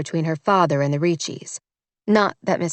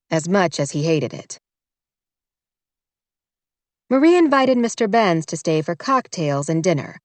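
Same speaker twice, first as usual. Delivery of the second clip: ordinary delivery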